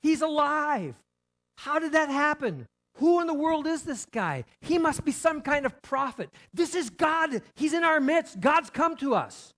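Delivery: high, loud cries of amazement; the crowd breaking out in astonished joy; amazed mourners. Retold or imitated. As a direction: mourners stopping their wailing to exclaim. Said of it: "and they're going".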